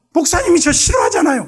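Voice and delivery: high, whiny sulking voice; sulking deacon. Retold or imitated. Jeolla deacon sulking at the pastor, high whiny complaint. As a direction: pout and complain.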